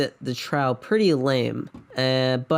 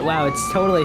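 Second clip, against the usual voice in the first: ordinary talk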